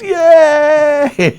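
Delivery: a very high, loud, excited cheer; loud cheering yelp; crowd cheer. Retold or imitated. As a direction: cheer like a whole crowd seeing the Queen. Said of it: "Everybody goes".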